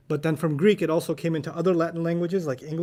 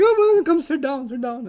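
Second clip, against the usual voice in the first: high, loud retold welcome; high-pitched mock eager invitation; imitating welcoming elders; 10 semitones higher, 5 dB louder than usual. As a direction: usher a newcomer in, voice pitched way up. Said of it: "They're like".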